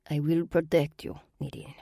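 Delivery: put-on calm, soothing Russian-accented promise; serenely. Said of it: "Varvera said serenely".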